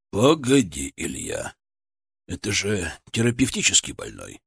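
lightly voiced boss, low and trailing down; reluctant senior surgeon. (сдержанный начальник, с лёгкой неохотой)

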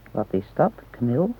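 ordinary delivery

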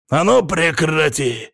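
loud, slow, high shouted command; angry yell. A narrator's reading. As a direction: yell to make her stop at once. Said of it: "заорал он". (заорать гневно и громко)

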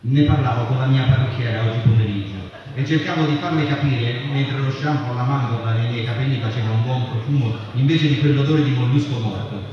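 ordinary talk

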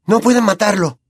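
high, urgent protest; a touch breathless, pitched high. A narrator's reading. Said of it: "dijo sin aliento".

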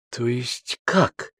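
hushed, stunned disbelief; gasped astonishment. A narrator's reading. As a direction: draw it out, taken aback. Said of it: "ахнула Римма".

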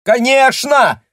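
high, loud, slow crowing shout; rooster voice. Acted. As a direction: crow it like a rooster. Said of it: "петушиным голосом закричал вслед".

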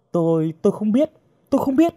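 high-pitched stammering protest; frightened denial. Acted. Captive girl to threatening interrogator, frightened denial. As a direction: plead ignorance, pitch climbing.

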